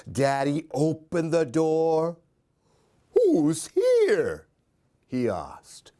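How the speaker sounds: lively, sing-song storybook reading; animated picture-book read-aloud; reading to children. A narrator's reading. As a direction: read it like story time for little ones.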